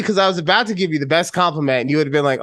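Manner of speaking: ordinary talk, nothing marked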